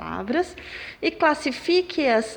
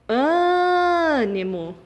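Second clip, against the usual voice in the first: Ânimo with the first syllable held long and high; AAA-nimo, sustained opening vowel; stressed syllable exaggerated; 4 semitones higher, much slower than usual. Shown as a demonstration. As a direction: stretch the Â of Ânimo, then drop off quickly. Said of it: "o ã é mais forte".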